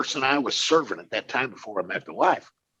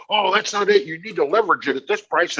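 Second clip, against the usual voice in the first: mocking the crowd; 6 semitones higher, 3 dB louder than usual. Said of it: "they go".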